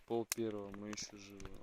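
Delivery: hushed, nervous night voice; nervous night vlog whisper. Real, not acted. Quiet night vlog at camp.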